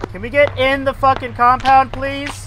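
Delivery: frustrated yelling. Real, not acted. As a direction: yell it at the blocking trucks. Frustrated driver yelling at convoy.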